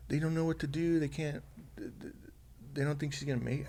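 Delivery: high-pitched retold distress, no sobbing; imitating hysterical crying. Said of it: "she's crying hysterically".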